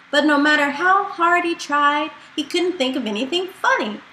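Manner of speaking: plain reading voice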